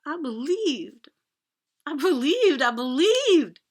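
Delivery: high, loud, slow "I believed" rising in force; anguished sobbing. Retold or imitated. Sobbing declaration to mom.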